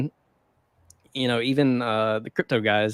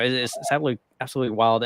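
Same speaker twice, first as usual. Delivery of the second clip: usual speaking voice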